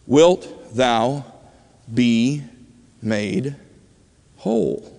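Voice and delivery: slow, separated words with long pauses; solemn question. Retold or imitated. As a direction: pose it gravely, one word at a time. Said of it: "So he asks the question".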